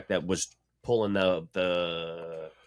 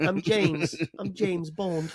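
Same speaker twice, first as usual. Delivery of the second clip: high-pitched child playing the spy; squeaky mock-English kid; little kid; 10 semitones higher, 3 dB louder, much slower than usual. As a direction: introduce yourself in a piping kid voice. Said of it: "like a little kid pop-up going".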